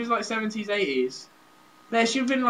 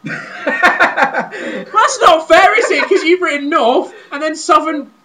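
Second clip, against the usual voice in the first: high, loud, laughing complaint; indignant protest; 9 semitones higher, 10 dB louder, much slower than usual. Real, not acted. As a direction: object loudly through laughter. Protesting a rigged search contest.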